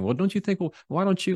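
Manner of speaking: high, pressing interruption; pushy arguing. Retold or imitated. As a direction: cut in and push your point. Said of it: "they're pushing".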